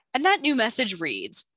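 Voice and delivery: usual speaking voice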